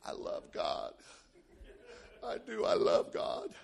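put-on low, hushed drunken mumble; slow, soft, voiceless boozy drawl. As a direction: imitate a drunk professing devotion from a bar stool. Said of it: "drunk as Cooter Brown".

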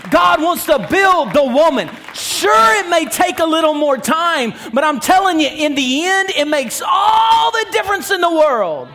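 high, loud sermon climax; full-force preaching. Real, not acted. Preacher tells church God builds who you are, impassioned shouting. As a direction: drive it home at a near shout.

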